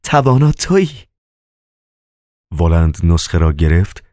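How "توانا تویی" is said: high-pitched ecstatic exclamation; rapturous cry. Acted. Margarita at Woland's feet, rapturous high cry. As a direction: exclaim high with great joy.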